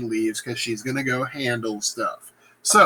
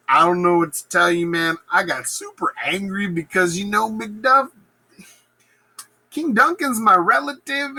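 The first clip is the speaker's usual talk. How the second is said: lame excuse; 8 semitones higher than usual. Acted. Macbeth excusing himself to Macduff, lame unconvincing bluster. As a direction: overplay the rage excuse. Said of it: "Macbeth's like".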